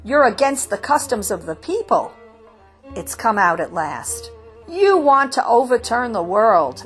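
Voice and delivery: threatening elders, lightly voiced; exclaiming. A narrator's reading. Householders threaten the rebellious chickens, mildly stern. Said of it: "the householders exclaimed".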